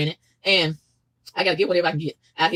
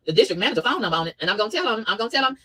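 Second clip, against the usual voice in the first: ordinary talk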